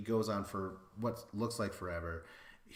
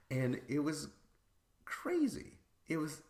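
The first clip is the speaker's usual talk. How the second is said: squealing very high on crazy; excited; 7 semitones higher than usual. Real, not acted. Describing the shocking finish to viewers, excited squeal. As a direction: burst up high with delight. Recapping the wild episode finish.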